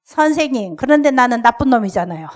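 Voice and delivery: loud, defiant first-grader; a defiant boy shouting at his teacher; defiant child. Retold or imitated. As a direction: fling it back at the teacher like a rebellious kid. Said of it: "아주 반항적인 태도를 탁 하면서".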